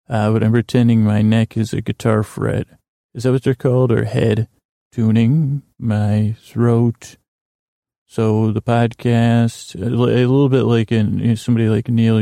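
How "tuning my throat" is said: very slowly stretched words; drawn-out throat tuning. Pretend. Host pretends his neck is a guitar, drawn-out tuning. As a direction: draw each word out like tuning a string.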